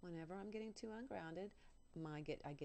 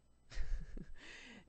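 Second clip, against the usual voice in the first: real loud laugh, breathy and bursting out; a sudden real laugh at her own words; burst of laughter; 6 semitones higher, 8 dB louder than usual. Real, not acted.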